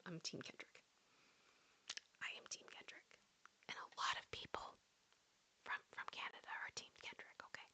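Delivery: very soft, playful whisper; mock ASMR whisper. Telling viewers where Canadians stand on [name], mock ASMR whisper. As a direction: whisper your verdict to the listeners.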